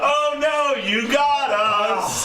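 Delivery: drawn-out, badly faked surrender; mock dismay. Accomplice pretending the guard caught them, hammed-up dismay. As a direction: give up with obviously fake alarm, stretching the words.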